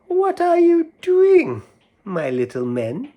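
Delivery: high sweet sly cat; high lilting pussycat voice; cat's voice. Acted. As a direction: coax like a sneaky cat.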